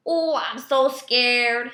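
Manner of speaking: slow, sarcastic mock fear. A narrator's reading. Otter mocking the gator with fake fright, slow and sarcastic. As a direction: pretend to be scared, all sarcasm. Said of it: "he mocks".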